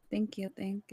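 soft whispered thank you; barely voiced, breathy thanks. Real, not acted. Whispered thanks after teasing.

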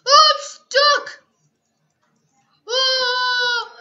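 high, loud panicked cry; panicked yell. Real, not acted. Stuck in game, panicking.

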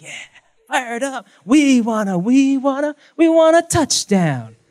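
high, loud crowd chant; stadium cheer. Retold or imitated. Football player caught up in the cheers, shouting. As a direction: shout for a touchdown.

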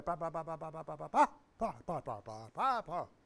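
mechanical rote babble on "pa" syllables; parroted line delivery. Retold or imitated. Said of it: "तोता रटन ... dialogue बोलता है".

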